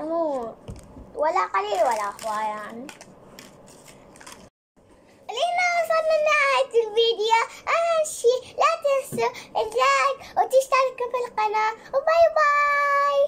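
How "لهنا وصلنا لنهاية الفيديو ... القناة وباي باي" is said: bright, quick sign-off voice; cheerful channel outro; upbeat outro. Real, not acted. Vlog outro. (ختام مرح للفيديو)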